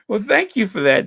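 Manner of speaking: laughing while talking. Real, not acted. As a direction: giggle through the thanks. Laughing at a viewer comment.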